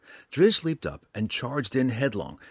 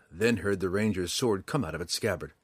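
ordinary delivery

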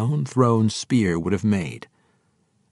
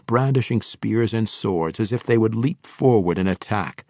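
ordinary delivery

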